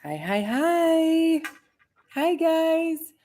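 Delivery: high sing-song hellos; stretched-out high hi hi hi; bright greeting. Real, not acted. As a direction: greet the viewers warmly and excitedly. Sing-song live stream greeting.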